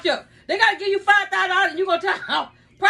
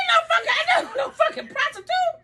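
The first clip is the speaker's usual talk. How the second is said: high laughing wail of denial; outraged protest; 8 semitones higher than usual. Real, not acted. Mother denying she is a prostitute, outraged.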